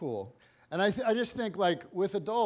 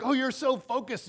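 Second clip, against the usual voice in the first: praise; 6 semitones higher, 4 dB louder than usual. Retold or imitated. Praising girl.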